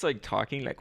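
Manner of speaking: ordinary delivery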